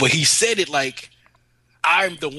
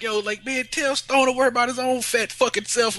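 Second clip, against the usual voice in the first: higher, mock-indignant comeback; indignant friend; 10 semitones higher than usual. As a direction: throw back the insult, half-laughing. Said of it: "And he's like".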